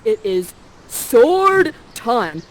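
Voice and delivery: slow, loud, hammed-up battle call; dramatic declaration. Ferdinand drawing his sword, in a slow loud mock challenge. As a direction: announce the fight grandly. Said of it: "Ferdinand is like this".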